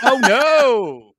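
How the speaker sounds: hammed-up laughing oh no; mock dismay. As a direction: echo it in mock horror. Echoing the father.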